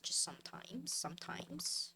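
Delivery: unvoiced, fading whisper; a wry whispered aside to viewers. Real, not acted. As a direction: breathe it out under your breath. Whispered trailing repeat.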